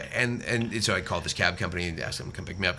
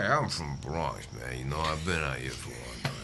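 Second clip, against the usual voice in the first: Bronx guy voice; 9 semitones lower, 7 dB quieter than usual. Retold or imitated. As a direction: imitate a Bronx neighbour, pitched way down. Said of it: "That's a great voice".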